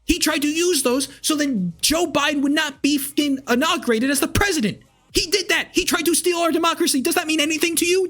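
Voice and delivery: real anger boiling over into shouting; furious rant. Real, not acted. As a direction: shout him down, all-out outrage. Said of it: "I'm very fucking furious about it".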